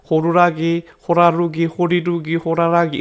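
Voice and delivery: 호루라기, 호라루기, 호리루기, 호라라기, middle vowels changing each try; inconsistent speech errors. Shown as a demonstration. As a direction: demonstrate failed attempts: 호라루기, 호리루기, 호라라기, vowels shifting. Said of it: "비일관적인 오류가 보일".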